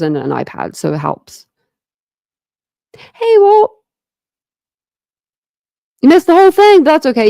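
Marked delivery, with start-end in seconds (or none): 3.0-7.3 high, loud, cheerful call-out; excited greeting. Real, not acted. Streamer calls out to Walt, who missed the stream, high and loud. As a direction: greet a friend who just arrived.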